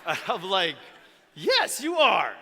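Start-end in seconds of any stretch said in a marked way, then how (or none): 1.3-2.3 high, loud encouraging yes; cheerful affirmation. Retold or imitated. Mentor encouraging a boy's dream, high and bright. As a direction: cheer him on like a proud dad.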